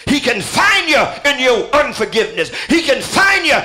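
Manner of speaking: fiery revival preaching; building preacher's litany. Acted. Preaching at full force.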